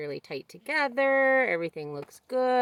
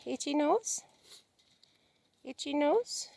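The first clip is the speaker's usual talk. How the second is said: higher voice, lilting up at the end; tender pet talk; 7 semitones higher, 4 dB quieter than usual. Real, not acted. Owner fussing over Layla, high tender pet talk. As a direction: coo a little question to a pet. Cooing to a sweet animal.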